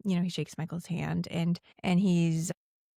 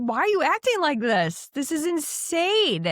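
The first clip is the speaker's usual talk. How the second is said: exasperated mom; 9 semitones higher, 3 dB louder than usual. Retold or imitated. Lucy scolding Sam at dinner, high exasperated. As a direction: scold your kid in disbelief.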